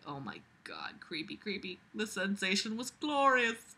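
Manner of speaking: usual speaking voice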